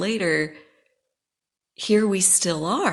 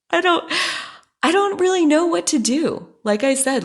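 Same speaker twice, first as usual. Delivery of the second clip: laughing it out, much higher and louder; exasperated laugh; 12 semitones higher, 5 dB louder than usual. Real, not acted. Telling listeners about her harasser, laughing in disbelief. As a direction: burst into an incredulous laugh.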